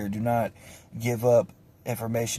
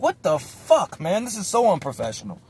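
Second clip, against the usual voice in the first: high, incredulous retold reaction; loud start, pitched up in disbelief; mock exasperation; 7 semitones higher, 3 dB louder than usual. Kid watching cops slam the table, high mock exasperation.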